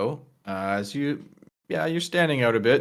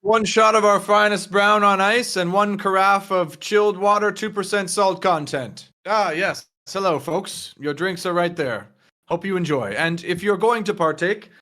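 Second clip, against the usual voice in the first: higher, crisply announced drink order; formal server; 6 semitones higher than usual. Acted. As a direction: present the drinks as an attentive server. Game master voices the server.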